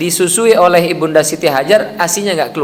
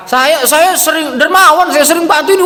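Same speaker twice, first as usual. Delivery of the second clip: high, louder self-praising voice; mock boaster; 11 semitones higher, 4 dB louder than usual. Pilgrim bragging that he often gives, high mock boast. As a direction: play up a man boasting of his charity. Acting a man boasting of generosity.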